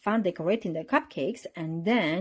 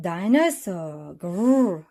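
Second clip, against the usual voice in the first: roaring dinosaur; 5 dB louder, much slower than usual. A narrator's reading. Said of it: "roared George".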